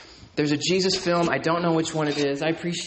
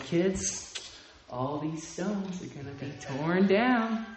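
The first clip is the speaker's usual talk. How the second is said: put-on a soft, slow film Jesus talking to children; lightly voiced gentle film Jesus explaining to kids; 7 dB quieter, slower than usual. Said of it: "and he's like".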